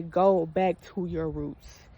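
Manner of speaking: slow, solemn divine voice; voice of God. Retold or imitated. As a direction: deliver it slow like a calling from above. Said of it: "I heard God say".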